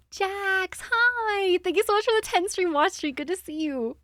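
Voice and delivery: high-pitched, delighted squeal of welcome; excited greeting. Real, not acted. Streamer welcoming viewer Jax, excited high greeting. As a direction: gush a high, thrilled hello.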